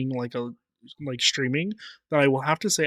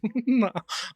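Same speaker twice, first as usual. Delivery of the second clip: high little mew; kitten mew; 9 semitones higher than usual. Shown as a demonstration. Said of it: "I'm mimicking Kitten".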